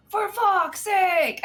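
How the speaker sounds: high, loud, drawn-out yell in imitation; mock an angry gamer's drawn-out shout; exasperated angry outburst. Angry teammate fed up with her tics, imitated exasperated yell.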